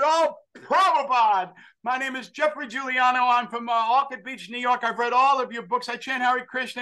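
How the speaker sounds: high, eager fanboy gush; gushing excitement. Retold or imitated. Eager hippie introducing himself to Prabhupada, high and gushing.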